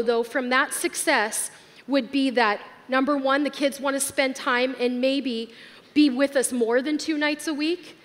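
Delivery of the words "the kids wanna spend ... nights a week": ordinary talk, nothing marked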